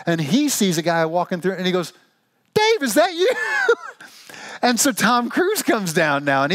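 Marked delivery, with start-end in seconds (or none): 2.5-3.3 very high, hammed-up surprise; surprised celebrity greeting. Tom Cruise spotting Dave in his yard, hammed-up surprise. 3.4-3.9 high laugh. Real, not acted. Punchline laugh after quote.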